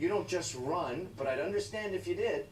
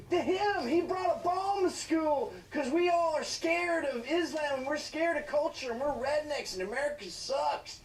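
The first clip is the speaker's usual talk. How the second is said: mock cop thoughts; 9 semitones higher, 3 dB louder than usual. Parodying the cops' thinking.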